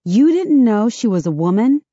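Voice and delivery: incredulous. Acted. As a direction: ask in disbelief. Sarah surprised question.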